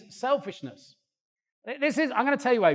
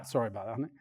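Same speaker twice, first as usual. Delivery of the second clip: ordinary talk, nothing marked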